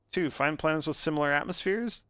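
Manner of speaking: ordinary talk, nothing marked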